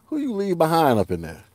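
normal talking voice

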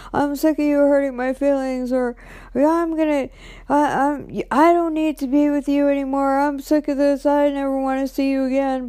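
hammed-up breakup rant; mock angry yelling. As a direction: imitate him storming off in words. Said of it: "he's yelling at me".

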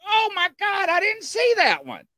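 very high, astonished exclamation; retold amazement. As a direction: replay the shock of a sudden discovery, voice way up. Retells discovering second figure.

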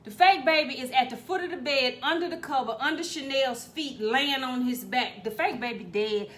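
ordinary talk, nothing marked

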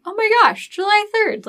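high, excited gasp of discovery; excited squeal. Retold or imitated. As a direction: squeal it like a happy surprise. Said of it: "then we were like".

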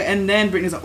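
normal talking voice

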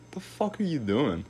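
half-yelled at the cousin in surprise; startled yell. Retold or imitated. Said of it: "I was like".

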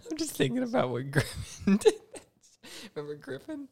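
giggling through the words; words squeezed out by laughter; laughing. Real, not acted. Laughing while recalling friend.

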